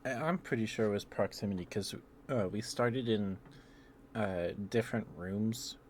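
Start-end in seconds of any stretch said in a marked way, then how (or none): none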